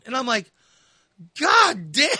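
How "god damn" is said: disgusted curse. Retold or imitated. Reacting to stench.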